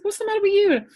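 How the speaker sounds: high, loud exasperated question; mock indignation. Owner to dog who refused her tea, mock indignant. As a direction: retell it as loud, high-pitched disbelief.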